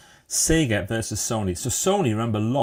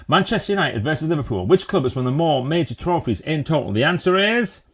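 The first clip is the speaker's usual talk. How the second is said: raised pitch, climbing at the end; quiz-host announcement; 6 semitones higher, 4 dB louder than usual. Pretend. Host putting a football trophy question to viewers, building announcement. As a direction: pitch it up like a game-show host.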